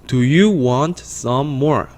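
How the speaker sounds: slow, evenly spaced words for repeating; deliberate teacher's read-along; slow model reading. Shown as a demonstration. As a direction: read it slowly so learners can follow. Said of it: "한 번 더 읽어볼게요".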